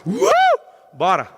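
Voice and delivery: pump-up shout. Acted. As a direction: fire yourself up to run. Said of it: "vou correr e falo". (grito de empolgação bem alto)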